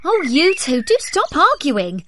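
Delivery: the blackbird telling them to stop. A narrator's reading. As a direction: chide the pair like a passing bird. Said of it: "said a blackbird".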